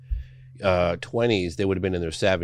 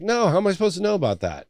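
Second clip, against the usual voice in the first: incredulous; 12 semitones higher, 4 dB louder, faster than usual. Retold or imitated. Retells own incredulous reply.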